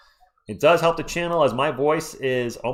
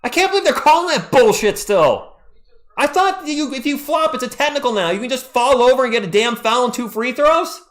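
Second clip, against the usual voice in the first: higher and louder, indignant; angry rant; 8 semitones higher, 5 dB louder than usual. Real, not acted. Streamer rants to viewers about the flop call, angry. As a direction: vent at the bad call. Ranting at the refs.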